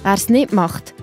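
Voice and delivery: ordinary delivery, nothing marked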